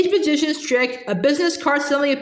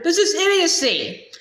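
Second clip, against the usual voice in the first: outraged higher cry; indignant; 5 semitones higher than usual. A narrator's reading. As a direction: protest in outrage. Lawrence swung around.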